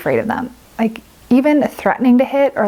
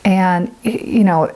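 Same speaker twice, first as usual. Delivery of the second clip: ordinary talk, nothing marked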